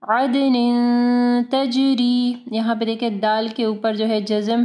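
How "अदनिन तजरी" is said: slow chant, bouncing d in अदनिन, long humming n; अदनिन तजरी with echoed d and j; qalqalah and ghunnah. Shown as a demonstration. Teacher models the phrase for students, slow tajweed. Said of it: "इख्फा गुन्ना होगा".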